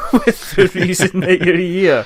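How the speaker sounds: amused at your own joke, laughing. Real, not acted. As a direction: crack up while finishing the line. Host cracks up at own joke.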